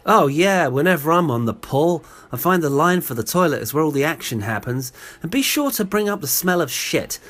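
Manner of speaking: loud, mock-eager sarcasm; sarcastic boast. Man mocking the idea he was hitting on her, sarcastic. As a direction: play up the ridiculous pick-up plan, loudly.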